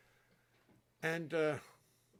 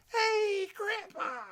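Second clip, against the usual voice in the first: put-on very high, much louder child's shout; excited child yell; 10 dB louder, much slower than usual. Grandpa imitating grandson shouting his greeting at dawn. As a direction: burst out like a kid flinging the door open. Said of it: "you can just yell, 'Hey, Grandpa,'".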